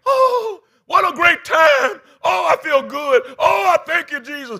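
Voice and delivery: high, loud, slow ecstatic shouting; imitated screaming joy. Preacher imitating his wife shouting with joy after the spa. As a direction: holler it out like she's overjoyed. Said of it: "she was screaming".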